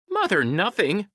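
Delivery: high taunting creature voice; high-pitched mocking sidekick. A narrator's reading. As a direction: jeer it in a high little voice. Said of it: "Wish taunted".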